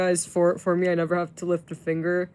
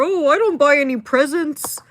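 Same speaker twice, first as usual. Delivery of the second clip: high, loud mocking voice of a cheap guy; mocking whiny guy; 9 semitones higher, 9 dB louder than usual. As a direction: sneer it out like a stingy fan. Mocking cheap guys' excuses.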